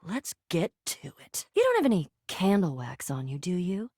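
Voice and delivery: low, teasing come-on; sultry low voice, softening at the end; seductive. Acted. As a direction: drop the pitch and flirt. Flirting in the bedroom.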